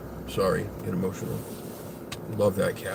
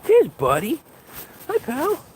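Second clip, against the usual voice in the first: high cooing greeting to the dog. Real, not acted.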